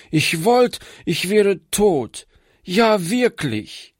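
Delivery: slow, high, breaking into tears; despairing, near sobs. A narrator's reading. Girl who has no one left, slowly breaking into sobs. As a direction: despair spilling over. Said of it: "begann sie laut zu schluchzen".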